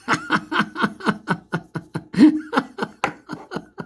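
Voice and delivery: a high, breathless giggle bursting out; shrieking laugh; high giggling laugh. Real, not acted. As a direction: crack up laughing at the absurdity. Cracking up at the clip.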